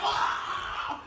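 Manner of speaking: drawn-out mock scream of a film victim; horror-victim scream, imitated. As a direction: let out a strained, toneless scream.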